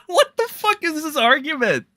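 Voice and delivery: high laughing outburst at the take; laughing disbelief. Real, not acted. Streamer laughing at a dumb take, incredulous.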